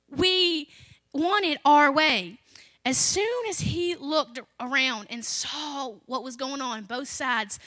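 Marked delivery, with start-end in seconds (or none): 0.1-2.4 louder, slower "want it our way"; punched point. Acted. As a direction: lean hard on "our way". Preacher hammers the point.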